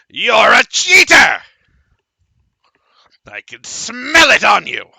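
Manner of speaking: high furious shout at the player; angry accusation. Acted. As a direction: yell it like a toddler throwing a tantrum. Wizard's tantrum at cards.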